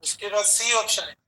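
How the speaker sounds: dictating each option word by word, slowly; teacher dictating answer options in a measured pace; slow dictation. Acted. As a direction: read it out so students can write along.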